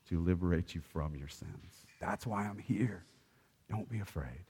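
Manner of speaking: soft, low, soothing comfort; tender Jesus. Acted. Jesus soothing terrified Peter, low soft voice. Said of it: "Get up, Peter".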